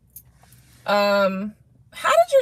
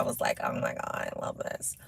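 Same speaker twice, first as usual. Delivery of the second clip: whisper; 15 dB quieter than usual. Retold or imitated. Retold delight, whispered.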